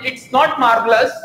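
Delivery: "marvelous" with the R sounded; wrong pronunciation. Shown as a demonstration. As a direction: say "marvelous" with a clear, hard R. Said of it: "हम जो बोलते आ रहे हैं मार्वलस वो गलत है". ("marvelous" में R बोलकर गलत उच्चारण)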